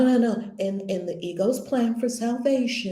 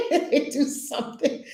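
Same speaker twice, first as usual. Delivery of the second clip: real laugh breaking into the words; 12 semitones higher than usual. Real, not acted. Teacher laughing at the big grievances, laughing. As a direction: laugh through the line. Laughs at abusive parents list.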